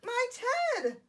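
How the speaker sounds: high, loud shocked exclamation; gasped. A narrator's reading. Bear spotting lost teddy, gasping. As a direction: cry out in storybook shock. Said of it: "gasped the bear".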